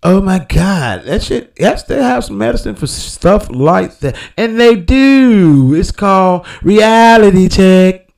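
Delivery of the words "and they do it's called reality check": slow, louder mocking retort; loud punchline. Real, not acted. Retorting to the caller with a loud slow punchline. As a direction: land the joke hard and slow.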